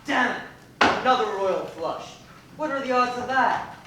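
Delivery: loud, exasperated curse at the cards; frustrated outburst. Acted. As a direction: shout it out after losing again. Losing at poker again.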